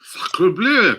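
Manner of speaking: co-host's jokey French exclamation, hammed-up French accent; mock French accent. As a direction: toss it off like a cartoon Frenchman. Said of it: "do a French accent".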